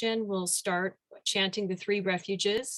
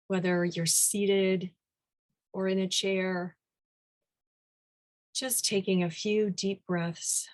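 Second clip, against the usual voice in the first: guided meditation. Real, not acted. Teacher leading the group into meditation, unhurried guided-meditation delivery. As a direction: ease them gently into stillness, phrase by phrase.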